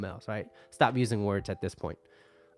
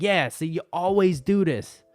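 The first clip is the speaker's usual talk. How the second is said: mock angry retort; 6 semitones higher, 6 dB louder than usual. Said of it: "And they're like".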